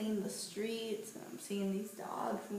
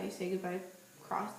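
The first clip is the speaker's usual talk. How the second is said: ordinary delivery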